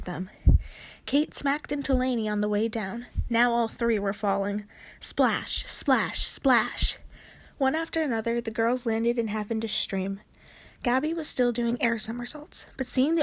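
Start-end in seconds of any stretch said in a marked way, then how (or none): none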